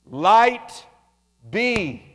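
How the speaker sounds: booming command, let there be light; God calls it into being. Acted. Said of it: "what did he say?".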